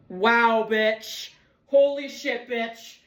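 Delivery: loud stunned holy shit; shocked exclaim. Real, not acted. Vlogger realizes something about her shirt, loud shock. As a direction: yelp in real shock.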